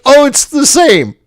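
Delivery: high, breezy clerk voice; rental clerk. Retold or imitated. Rental clerk brushing it off, breezy and high. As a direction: chirp it like it's no big deal. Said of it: "they're like".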